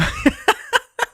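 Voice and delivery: a squeaky rising giggle; distracted high-pitched laugh. Real, not acted. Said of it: "sorry, getting distracted".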